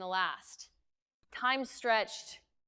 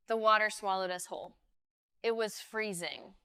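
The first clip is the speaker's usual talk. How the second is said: ordinary delivery, nothing marked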